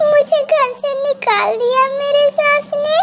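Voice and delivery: high-pitched, hammed-up crying complaint; thrown-out bride's high, weepy lament; tearful wailing. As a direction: wail pitifully through sobs. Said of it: "किसी पक्षी के रोने की".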